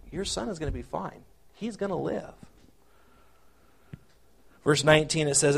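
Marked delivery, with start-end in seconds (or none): 0.1-2.3 soft, tender promise; gentle reassurance. Acted. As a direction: comfort a frightened mother. Paraphrases God reassuring Hagar.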